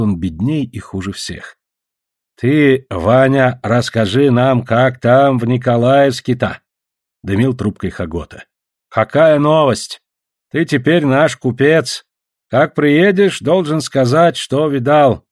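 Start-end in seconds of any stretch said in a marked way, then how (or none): 2.4-6.6 put-on slow, drawn-out old villager's voice; lightly voiced villager, slow and deliberate; curious old villager. 8.9-15.2 slow, deliberate villager voice, slightly raised; lightly voiced old villager; curious old villager.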